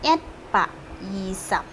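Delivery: teacher sounding out "Yisap"; Hokkien number. Shown as a demonstration. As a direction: model "Yat, Pak. Yisap." for students. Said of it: "Seratus dua puluh gimana ngomongnya".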